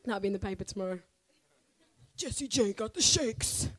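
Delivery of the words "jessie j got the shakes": mock headline. Mocking tomorrow's newspaper headline.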